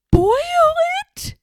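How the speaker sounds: high, shocked gasp of disbelief. Acted. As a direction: gasp it out, horrified. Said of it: "gasped Horton".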